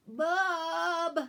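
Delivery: loud high call with a mock blocked nose; stuffed-up call. Sick boy calling for his mom, stuffed-up loud call. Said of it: "with his weird, all wrong, stuffed up voice".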